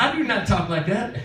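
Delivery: high-voiced mock protest; comic high imitation of a denial. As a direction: mimic his objection in a high caricature. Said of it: "He said".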